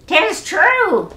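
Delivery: high squawking parrot voice. Acted. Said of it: "squawked the bird".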